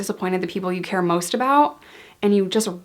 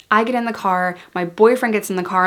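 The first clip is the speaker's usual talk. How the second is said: usual speaking voice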